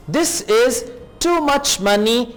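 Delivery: ordinary delivery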